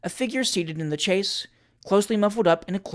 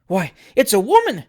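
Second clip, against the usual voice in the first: ordinary delivery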